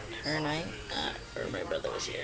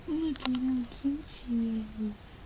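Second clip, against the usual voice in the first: cooing; 8 semitones higher, 4 dB quieter, slower than usual. Real, not acted. Cooing over the baby.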